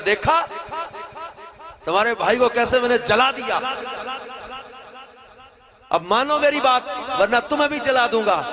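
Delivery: loud, high gloating threat; arrogant king. Acted. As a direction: sneer down at the captives. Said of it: "بادشاہ بڑے تکبر سے مڑا". (متکبر بادشاہ کی دھمکی)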